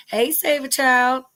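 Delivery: ordinary talk, nothing marked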